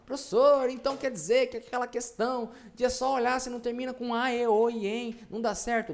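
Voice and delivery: high, loud pupil protesting to the teacher; questioning student. Acted. Student challenging the professor about the rule, high-pitched objection.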